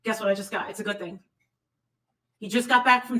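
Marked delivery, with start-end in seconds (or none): none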